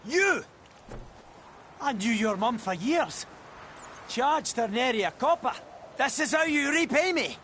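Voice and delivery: indignant accusation. Acted. Smith confronts the man who burned his forge, indignant. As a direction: confront the one who betrayed you.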